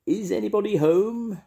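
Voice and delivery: high, loud hallooing call. A narrator's reading. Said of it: "called out".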